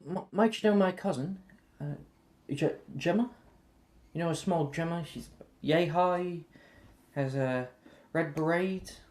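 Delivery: put-on high, lively Irish girl; chirpy young Irish introduction. Saoirse asks about cousin.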